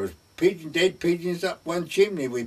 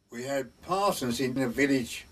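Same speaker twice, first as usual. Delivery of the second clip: ordinary talk, nothing marked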